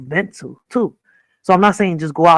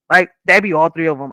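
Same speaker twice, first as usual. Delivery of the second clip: ordinary talk, nothing marked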